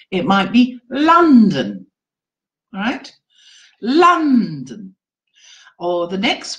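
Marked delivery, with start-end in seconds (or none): 0.9-1.9 "London" with a long, strong first syllable; stress on first syllable. Shown as a demonstration. Teacher showing trainees first-syllable stress, "London" stressed on LON. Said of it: "stressed on the first syllable". 3.8-4.9 "London" drawn out on LON, falling away; stress on first syllable. Shown as a demonstration. Teacher repeating the example word, "London" with stressed first syllable. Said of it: "stressed on the first syllable".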